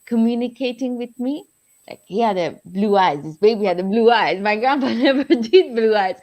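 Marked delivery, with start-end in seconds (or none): 4.4-6.2 laughing through it; a laugh breaks in. Real, not acted. Laughs mid-line.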